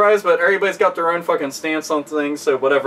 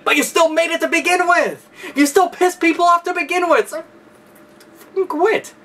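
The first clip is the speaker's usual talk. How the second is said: real frustrated yell at a game company, pitched way up; exasperated outburst; 9 semitones higher than usual. Real, not acted. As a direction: voice shoots much higher in real exasperation.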